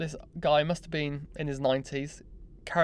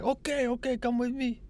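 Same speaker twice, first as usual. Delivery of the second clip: imitating the Turkish guy; 9 semitones higher than usual.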